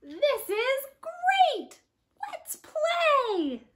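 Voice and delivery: high, loud happy piggy voice; joyful Piggy. Acted. Piggy playing in the rain, high and joyful. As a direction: shout with glee while playing.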